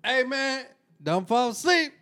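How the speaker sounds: high, hammed-up warning voice; mock horror warning. Joker warns a friend about Freddy, mock alarm. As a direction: play the panicked warner, over the top.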